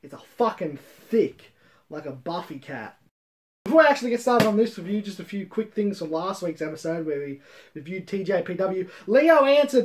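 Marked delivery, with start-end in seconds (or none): none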